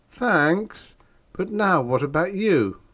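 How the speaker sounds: high-pitched, contained eagerness; mildly anxious, keep it polite and high; trying not to seem over-anxious. A narrator's reading. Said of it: "trying to not appear over-anxious".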